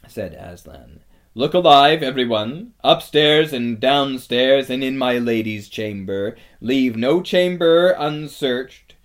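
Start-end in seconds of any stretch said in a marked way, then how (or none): 1.3-8.9 loud, rallying order; rally everyone with a raised voice; rousing command. A narrator's reading. Aslan sends everyone to search the castle, rousing command.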